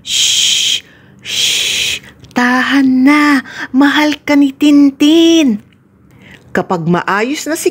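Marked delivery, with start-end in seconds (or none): 0.0-5.6 whispered shh, slow tender hush; soothing little sister. Acted. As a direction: comfort her brother slowly and gently.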